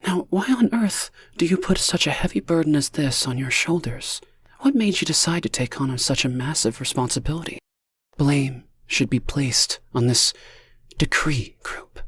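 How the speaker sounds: gentle scolding comfort. A narrator's reading. Lonnie telling Chris not to blame himself, gentle.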